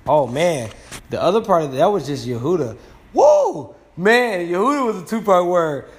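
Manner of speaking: high, loud burst of sudden discovery; excited realisation. Real, not acted. Teacher catches his own slip about the two-part word, excited.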